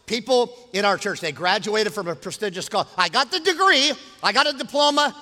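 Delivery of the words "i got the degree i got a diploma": loud, raised preacher's quote; frustrated protest. Acted. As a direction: voice a graduate's complaint, high and forceful. Preacher voices frustrated graduate.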